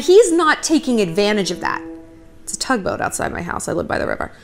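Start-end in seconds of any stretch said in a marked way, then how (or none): 2.4-4.3 whispered aside about the tugboat; hushed aside. Real, not acted. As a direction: drop to a whisper for a quick aside. Aside about noise outside.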